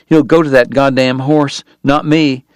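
plain reading voice

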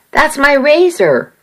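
lightly voiced angry shout. A narrator's reading. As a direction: call it out, protesting. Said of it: "I heard Sam shout".

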